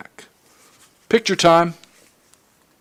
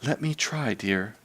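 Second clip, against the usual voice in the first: soft calm offer; calm wife to fussing husband; placid; 8 dB quieter than usual. Acted. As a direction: soothe him quietly. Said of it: "Missus Darling was placid".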